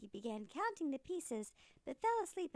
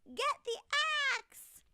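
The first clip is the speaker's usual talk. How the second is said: yelling; 13 semitones higher, 8 dB louder than usual. Acted. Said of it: "he yelled".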